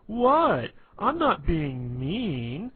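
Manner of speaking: high, loud, slow mock-innocent protest; sarcastic. Sarcasm Man denying he's mean, loud sarcastic whine. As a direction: protest your innocence so it sounds like mockery. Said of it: "everything he said sounded really sarcastic".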